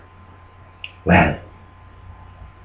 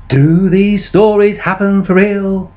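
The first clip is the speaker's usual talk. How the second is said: storyteller's introduction; 3 semitones higher than usual. Acted. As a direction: draw the audience into the tale. Introduces the play.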